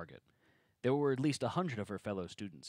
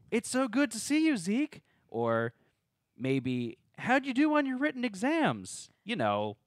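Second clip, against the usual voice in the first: high mocking voice, raised and louder; mocking sing-song; 11 semitones higher, 6 dB louder, slower than usual. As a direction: play it as a teasing mock greeting. Zeke mimics expected greeting.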